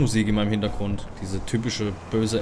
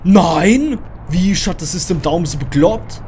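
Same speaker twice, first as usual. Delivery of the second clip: loud, high, incredulous outburst; outraged protest; 6 semitones higher, 7 dB louder than usual. Real, not acted. Player told to shut the system down, outraged protest. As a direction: shout back in panicked disbelief.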